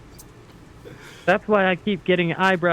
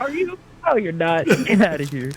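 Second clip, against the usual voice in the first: mock disbelief; 6 semitones higher than usual. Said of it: "annoy the fuck out of me".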